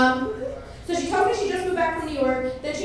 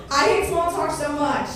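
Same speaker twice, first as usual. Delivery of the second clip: ordinary talk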